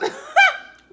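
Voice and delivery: short high-pitched laugh; high giggle. Real, not acted. Searching for a word.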